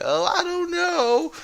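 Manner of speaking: very high, slow unsure squeak; hesitant criminal. Retold or imitated. Criminal unsure about robbing the carriage, high squeaky hesitation. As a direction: go way up, dithering. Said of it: "this criminal's like".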